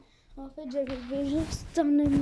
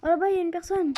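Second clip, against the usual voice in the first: higher, louder enemy callout; excited callout; 5 semitones higher, 8 dB louder, much faster than usual. Real, not acted. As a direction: call out the enemy you just spotted. Spots an enemy.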